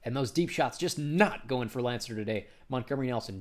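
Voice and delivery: normal talking voice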